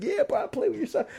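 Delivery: very high, chummy reply; match his delight, voice way up; retold buddy-buddy gush; enthusiastic bonding. Bonding with the proud father.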